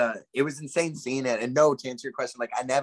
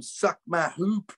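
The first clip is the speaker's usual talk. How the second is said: mock defiant; 5 semitones higher, much slower than usual. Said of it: "No, I'm kidding".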